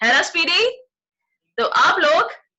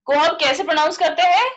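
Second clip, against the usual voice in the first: ordinary delivery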